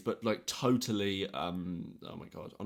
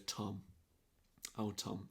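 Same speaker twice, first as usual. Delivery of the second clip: 조용히 타이르는 말투; 12 dB quieter, slower than usual. Retold or imitated. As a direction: quietly imitate a teacher sighing a student's name. Retold teacher addressing him softly.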